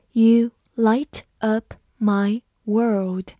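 slow, word by word; slow reading. Shown as a demonstration. Teacher reading slowly for students to follow. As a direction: read it slowly for learners. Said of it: "好，慢慢来读。".

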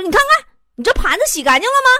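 冲经理发火质问; 生气的妈妈. Retold or imitated. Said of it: "我妈特别生气". (模仿妈妈又快又响地生气质问)

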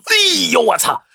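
high, strained character voice, wailing; hammed-up injured man's protest; pained outcry. A narrator's reading. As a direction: wail and complain in agony. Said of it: "马王爷大叫一声".

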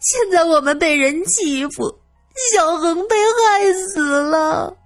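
high, slow, drawn-out keening; wailing lament. Acted. Widow lamenting her dead husband and son, wailing. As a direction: wail each phrase out like a mourner at a funeral. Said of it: "小恒开始哭程大先生".

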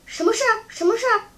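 urgent, startled inquiry; hurried asking. A narrator's reading. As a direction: ask it urgently, twice over. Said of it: "忙问".

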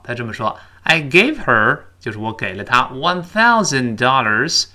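usual speaking voice

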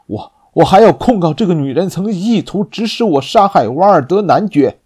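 rising, loud furious accusation; angry accusation. Acted. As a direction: accuse her with mounting rage.

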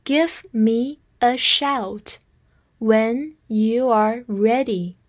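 slow, phrase-by-phrase reading. Shown as a demonstration. Teacher reading slowly for students to repeat. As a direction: read it slowly in two chunks. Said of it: "我们慢慢来说".

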